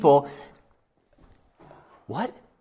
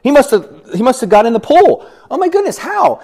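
higher, louder gossip voice with laughter; gossiping people; 6 semitones higher, 8 dB louder than usual. Retold or imitated. Gossiping townspeople spreading news of the healing, high and loud. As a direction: gush like excited townsfolk. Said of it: "you know how people are".

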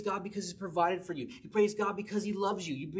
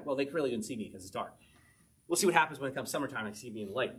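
usual speaking voice

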